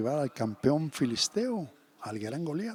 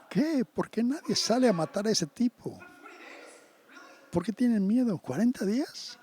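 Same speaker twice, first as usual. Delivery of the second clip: high, incredulous outburst; can't believe no one fights him; indignant disbelief; 6 semitones higher than usual. Retold or imitated. Boy David to the frightened soldiers, high incredulous disbelief.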